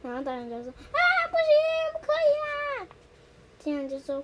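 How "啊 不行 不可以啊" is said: very high, loud panicked cry; panicked refusal. Acted. Nobita refusing Shizuka's photo, panicked and loud. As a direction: shout no in a panic.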